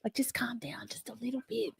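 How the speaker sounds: soft whisper, acting out a dog calming another; gentle hushing. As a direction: whisper it gently to settle someone down. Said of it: "gently grabbing a hold of her and, like, kind of going".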